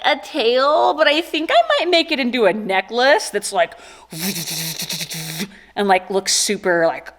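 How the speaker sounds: ordinary delivery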